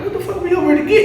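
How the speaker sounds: high, rapid incredulous question; retold disbelief. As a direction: blurt it out as mock bewilderment.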